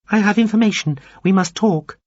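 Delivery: high, thin, formal alien voice; a prim little alien addressing him; small alien voice. A narrator's reading. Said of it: "the G F C Effian said".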